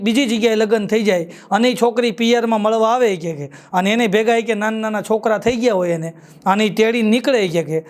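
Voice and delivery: ordinary talk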